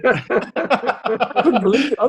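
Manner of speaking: slow, high, laughing through the words. Real, not acted. MP recalling sitting beside a poacher, laughing. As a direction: drag it out in amused disbelief.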